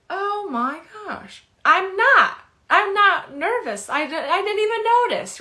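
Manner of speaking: high, loud, amazed disbelief; surprised. Retold or imitated. Client telling her friends she wasn't even nervous, high amazed. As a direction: squeal in happy surprise.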